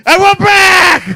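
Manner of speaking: high, slow, blasting announcer yell; big announcer shout. Acted. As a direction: belt it like a show host. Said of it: "That physically hurt".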